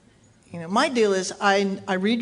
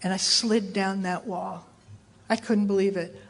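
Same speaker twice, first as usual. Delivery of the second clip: ordinary talk, nothing marked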